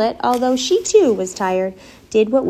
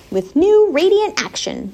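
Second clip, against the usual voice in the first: slow, higher-pitched reading; slow repeat; 8 semitones higher, slower than usual. A narrator's reading. Charlotte repeats the soap ad to herself, slowly. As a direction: read "new radiant action" slowly, weighing each word. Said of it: "repeated Charlotte slowly".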